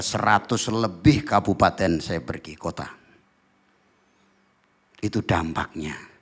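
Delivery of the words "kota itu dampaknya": ordinary talk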